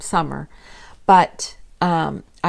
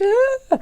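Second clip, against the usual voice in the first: panicked gasp; 7 dB louder than usual. Retold or imitated. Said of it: "I was like".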